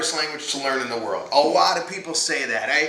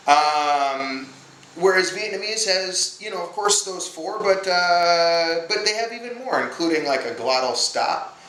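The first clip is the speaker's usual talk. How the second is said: ordinary talk, nothing marked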